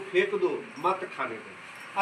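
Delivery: फेंक दो, then a low, slow मत खाने दो; pause after दो. Shown as a demonstration. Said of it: "इसमें अगर कोमा लग गया".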